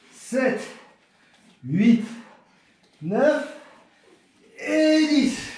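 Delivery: counting the last reps; doing push ups. Real, not acted. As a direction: push through the final reps. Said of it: "Les dernières pompes".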